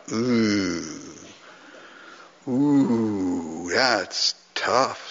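drawn-out, low wincing ooh; pained awe. Retold or imitated. Hearing a tough truth at a conference, pained awe. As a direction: let the hard truth sink in slowly. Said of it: "I thought".

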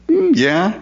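high, drawn-out hmm of concession; musing God. Retold or imitated. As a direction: voice God shrugging it off. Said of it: "And then he says this".

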